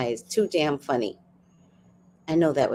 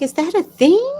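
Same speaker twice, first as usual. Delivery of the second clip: surprised; 12 semitones higher, 6 dB louder than usual. Retold or imitated. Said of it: "I was like".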